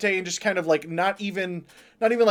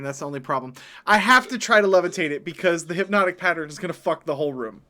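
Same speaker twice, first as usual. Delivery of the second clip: ordinary delivery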